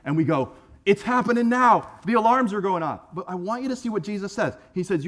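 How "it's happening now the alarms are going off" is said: mock alarm, higher and louder; hammed-up panic about the end times; overexcited. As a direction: blurt it like the rapture alarm just rang. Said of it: "we get overexcited".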